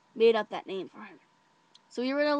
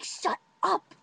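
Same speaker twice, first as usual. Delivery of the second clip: high-pitched hushing; urgent shushing; 9 semitones higher than usual. Retold or imitated. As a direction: shush her in a panic. Hushing loud friend.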